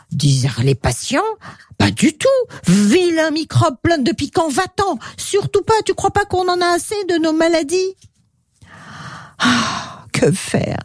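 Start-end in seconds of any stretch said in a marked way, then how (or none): 1.8-7.9 high-pitched, outraged patients; indignant refusal. Acted. Patients rebuffent Pixie avec indignation. 9.4-10.8 mild, hopeless sigh; a touch of helpless sighing; sighing despair. A narrator's reading. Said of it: "Il soupirait".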